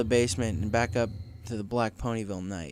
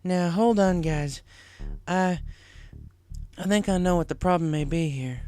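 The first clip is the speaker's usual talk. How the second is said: Applejack voiced; 7 semitones higher than usual. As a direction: stop them, hesitant.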